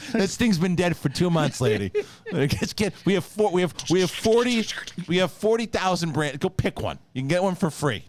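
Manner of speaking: high, animated, pleading rant; mock exasperation. Store worker begging fish lady to take another, mock-exasperated. Improvised fish-return riff as staff.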